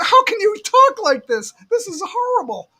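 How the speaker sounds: imitating an appalled outburst; retold shock. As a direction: wail it in mock horror, easing off in volume. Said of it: "I was in shock".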